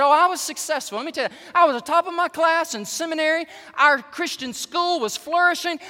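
loud, high, fast boastful rant; proud angry pastor. Retold or imitated. Preacher voicing a boastful pastor, loud and fast. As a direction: bluster like a proud man of God.

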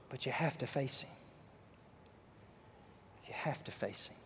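soft, low, near whisper; quiet grave warning to the congregation; hushed warning. Acted. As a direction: drop to a solemn hush.

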